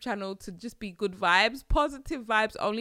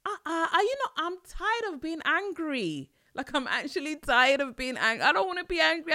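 exasperated whine; 7 semitones higher, 5 dB louder than usual. Real, not acted. Exasperated rant, baby girl.

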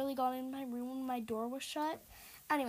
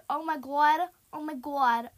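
loud, alarmed Boston accent; 2 semitones higher, 10 dB louder than usual. Real, not acted. Gamer coping with being chased, Boston accent. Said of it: "Talking in a Boston accent".

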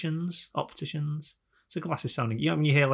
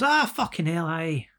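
higher-pitched Scottish exclamation; Scottish accent; 6 semitones higher, slower than usual. Retold or imitated. Frank setting off down the road, imitated Scottish accent. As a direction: imitate a Scot grumbling aloud. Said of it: "he's a Scottish guy".

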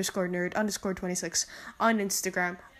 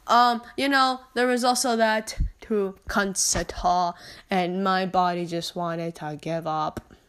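ordinary delivery

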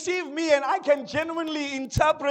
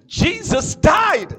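slow, loud, drawn-out cry; loud slow preacher's proclamation; preaching shout; 5 semitones higher, 9 dB louder, much slower than usual. Real, not acted. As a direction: hammer it home from the pulpit.

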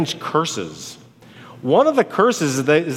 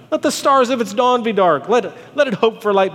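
raised-pitch, impassioned proclamation; declaimed curse; 7 semitones higher than usual. Acted. Preacher voicing Job cursing his birthday, impassioned. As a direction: pronounce a bitter curse aloud. Job's curse read dramatically.

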